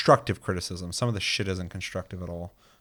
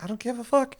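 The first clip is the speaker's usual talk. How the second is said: very high, shrugging dismissal; dismissive. Retold or imitated. Host shrugs off name mix-ups, high and dismissive. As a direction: toss it off like nothing matters. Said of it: "it's just like".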